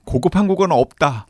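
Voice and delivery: punchy vintage slogan; chipper 1950s ad. Pretend. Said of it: "옛날에 광고하는 것처럼".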